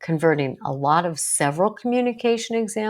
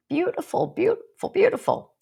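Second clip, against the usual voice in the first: delighted praise; 13 semitones higher than usual. Real, not acted.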